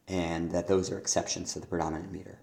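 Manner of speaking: ordinary talk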